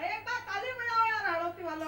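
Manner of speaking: loud call to the Hadoti crowd for applause. Real, not acted. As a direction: shout for a round of applause. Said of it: "calls audience for applause".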